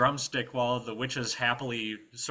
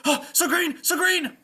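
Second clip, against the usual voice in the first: high, loud panicked squire; urgent calling; 10 dB louder than usual. Acted. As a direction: call out frantically for your master. Squire calling his master.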